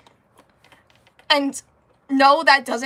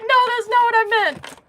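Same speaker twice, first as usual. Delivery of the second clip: high and louder, hurried correction; flustered backpedal; 11 semitones higher, 6 dB louder than usual. Retold or imitated. Luna correcting herself to a sad Gemini, high and flustered. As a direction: scramble to fix the misunderstanding. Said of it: "She's like".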